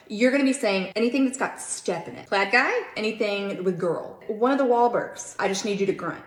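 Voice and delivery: loud, eager bandleader pep talk; rally the band before the take; hyped-up rally. Acted. Director pumping up the band for a take, loud and eager.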